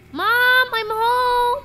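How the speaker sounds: high, loud sing-out; calling out. Pretend. Player pretending to come home to mother, playful call. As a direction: holler it through the house, drawn high. Jokingly calls as if coming home.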